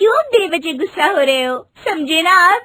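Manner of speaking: wife giggling to calm husband; giggly teasing. Acted.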